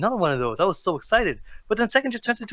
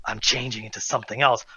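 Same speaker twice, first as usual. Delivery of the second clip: ominous trailer line; 6 semitones lower, 4 dB quieter than usual. Acted. Mimics trailer's ominous turn.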